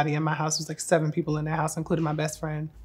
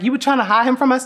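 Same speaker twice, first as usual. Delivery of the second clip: higher, louder, quick mock indignation; mock-accusing question, pitched up and rushed; retold indignation; 7 semitones higher, 6 dB louder, faster than usual. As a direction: imitate nosy friends demanding an answer. Voicing everybody's indignant question.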